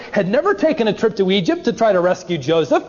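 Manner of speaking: usual speaking voice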